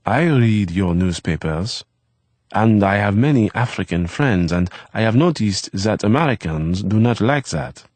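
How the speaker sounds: lightly voiced young Frenchman, grand and measured; a touch of lofty dignity in a French lilt; understated majestic pronouncement, a hint of French; majestically. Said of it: "said Eve majestically".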